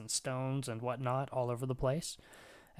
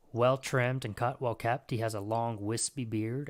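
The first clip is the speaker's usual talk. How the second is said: ordinary delivery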